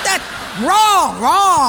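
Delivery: loud, high, drawn-out buzzer call; emphatic rejection. Acted. Personnel guy rejects the holy guy's answer, loud and high. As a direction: shoot the answer down loudly.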